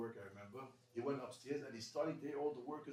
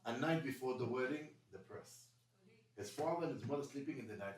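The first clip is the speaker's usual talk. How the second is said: ordinary talk, nothing marked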